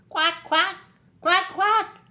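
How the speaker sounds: high, loud little duckling quacks. A narrator's reading. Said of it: "just as loud as their little quackers could quack".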